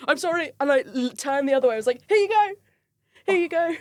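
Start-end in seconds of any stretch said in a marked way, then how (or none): 0.1-0.5 high-pitched panicked blurt, retold; acting out embarrassed panic; flustered. Flustered handing back his phone. 2.1-3.8 high, squeaky mock-mortified offer; re-enacted awkward high-pitched squeal. Mortified, looking away, handing phone back.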